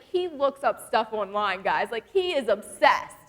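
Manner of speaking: usual speaking voice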